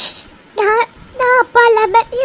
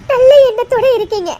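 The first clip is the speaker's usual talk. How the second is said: high, louder tearful laugh; laughing through tears; 5 semitones higher, 4 dB louder than usual. Acted. Mother grateful to the family, laughing through tears. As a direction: laugh out the relief while crying.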